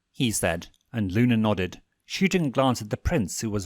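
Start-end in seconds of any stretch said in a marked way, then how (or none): none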